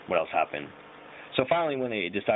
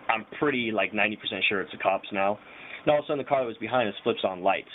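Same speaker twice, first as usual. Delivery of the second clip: usual speaking voice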